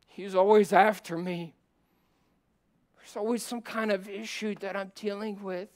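rising sermon plea; fervent preaching. Acted. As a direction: plead for help from the pulpit. Preaching climbs again.

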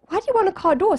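high-pitched puzzled challenge; baffled question. Retold or imitated. Friends puzzled by his odd wish, high and baffled. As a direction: demand an explanation, voice lifted high.

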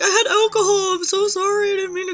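apologizing to the boss through mock sobs; crying apology. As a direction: wail it like a drunk, sorry mess.

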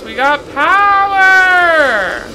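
slow, loud triumphant shout; excited shout. Real, not acted. Streamer cheering as the machine powers on, loud. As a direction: cheer it out drawn long.

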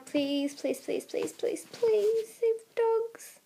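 soft, slowing whispered begging; whispered pleading. Real, not acted. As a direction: beg in a hush, fading away. Kid pleading to save dogs.

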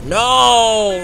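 high, loud, drawn-out no; dismayed shout. Real, not acted. Player hit just when doing well, loud dismayed cry. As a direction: cry out as it all goes wrong.

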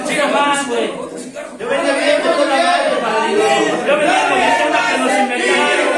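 loud, hammed-up yelling of a complaint; mock shouting. Newcomer ranting to his padrino, loud mock yell. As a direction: shout it like a man ranting at a meeting.

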